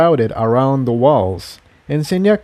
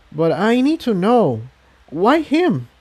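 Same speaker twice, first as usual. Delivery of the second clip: higher, pleading mare voice; lightly voiced pony; 8 semitones higher than usual. A narrator's reading. Tiptoe pressing her friend, higher and earnest.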